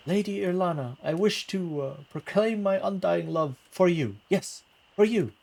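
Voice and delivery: lightly voiced nervous suitor bard; a hint of a gushing minstrel; lovestruck bard voice. Bard declaring love theatrically.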